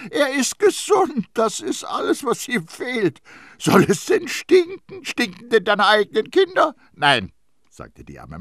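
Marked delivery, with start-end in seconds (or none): 0.1-6.7 very high, loud tirade; shrieked indignant argument; exasperated outburst. A narrator's reading. Priest loses patience in quarrel. 6.9-7.3 firm refusal. A narrator's reading. Nurse answers the challenge.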